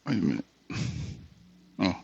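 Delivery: ordinary delivery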